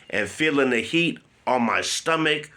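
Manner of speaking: normal talking voice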